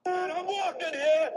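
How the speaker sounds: high, shouted put-on "I'm walking here"; mock movie yell. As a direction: yell it like an annoyed New Yorker in the street. Joke quote on a walk.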